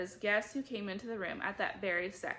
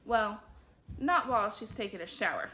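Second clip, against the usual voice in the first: usual speaking voice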